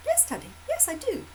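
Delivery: put-on squeaky eager child answering daddy; high little child voice. Little girl answering her dad, high child voice. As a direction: pitch way up as a small girl. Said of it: "she's like".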